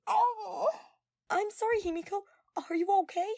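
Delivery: high, loud, anxious apology; flustered apology. Acted. Girl apologizing after bumping her friend's nose, high and loud. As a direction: gasp out a flustered sorry.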